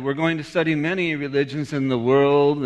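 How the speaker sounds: normal talking voice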